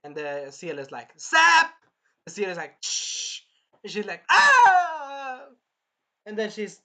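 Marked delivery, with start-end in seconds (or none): 1.2-1.7 high, loud, punched zap; exclaimed cartoon zap; zap effect. Imitates seal zapping. 2.8-3.4 imitated "shh" zap sound; hush-like electric fizz noise; sizzling seal noise. 4.3-5.4 high, loud drawn-out mock scream; hammed-up wail of someone shocked; zapped scream. Acts princess being zapped.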